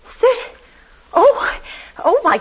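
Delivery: high astonished gasp of a word; thrilled at the money; high, amazed exclamation; delighted surprise. Acted. Surprised at generous rent.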